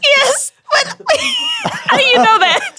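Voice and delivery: high-pitched laughing squeal of disbelief; real astonishment. Real, not acted. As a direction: shriek it out, stunned and laughing. Caught out by her old company.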